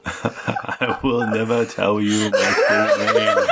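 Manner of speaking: cat voice laughing. Acted.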